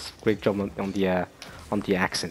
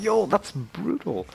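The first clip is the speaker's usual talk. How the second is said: high, drawn-out disbelief; shocked reaction; 9 semitones higher, slower than usual. Retold or imitated. Fan reacting to a brutal fight, high shocked exclamation. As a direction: stretch it out, stunned and wowed. Retelling own shock at violence.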